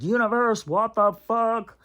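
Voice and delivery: yelling up at the universe in disbelief; mock plea to the universe; exasperated outcry. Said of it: "I'm like".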